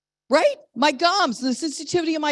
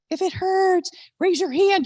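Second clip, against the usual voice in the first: "if it hurts" repeated much higher; imitated instruction; 6 semitones higher than usual. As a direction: mimic the dental worker, pitched up on "hurts". Quotes dental worker, repeat pitched up.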